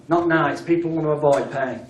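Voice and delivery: ordinary talk, nothing marked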